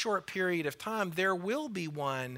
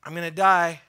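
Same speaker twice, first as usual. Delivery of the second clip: loud; 10 dB louder than usual. Acted. Jesus foretelling death, repeated.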